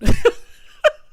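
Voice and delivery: loud high burst of laughter. Real, not acted. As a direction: cackle at your own joke. Laughs at own joke.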